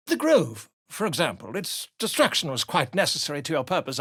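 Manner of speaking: ordinary talk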